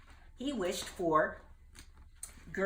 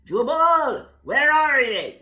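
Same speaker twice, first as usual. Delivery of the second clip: higher, louder call from far off; calling from afar; 8 semitones higher, 14 dB louder, slower than usual. Acted. Gertie searching for Jubal, calling from afar. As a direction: call across the storm. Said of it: "A faint voice called in the distance".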